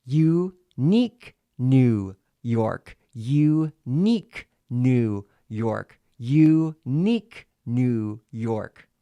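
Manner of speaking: very slow, loud, separated syllables; staccato with pen in teeth. Shown as a demonstration. Coach demonstrating three repetitions, loud slow staccato. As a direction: punch each syllable apart round a pen. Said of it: "staccato and legato".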